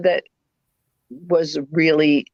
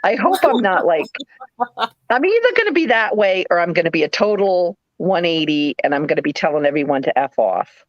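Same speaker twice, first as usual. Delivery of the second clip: normal talking voice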